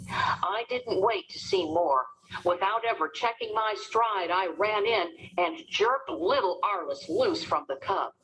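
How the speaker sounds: usual speaking voice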